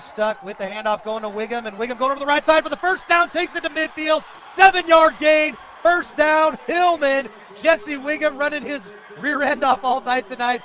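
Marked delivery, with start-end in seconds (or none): 1.7-10.6 loud, high, punchy touchdown-style call; excited play-by-play call. Real, not acted. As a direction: belt out the big first down, building fast then punching each phrase.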